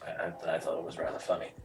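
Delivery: put-on old man voice. Old man character reply.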